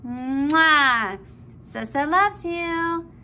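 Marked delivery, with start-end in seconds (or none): 0.1-1.2 loud smacking kiss; affectionate kiss noise; kiss sound. Real, not acted. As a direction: blow a big kiss. Blowing kiss to baby.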